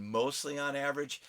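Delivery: usual speaking voice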